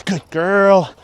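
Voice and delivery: excited dog praise. Real, not acted.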